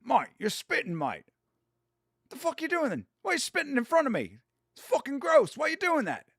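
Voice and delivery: high-pitched mimicked English accent, scolding; thick English accent. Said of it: "very thick English accent".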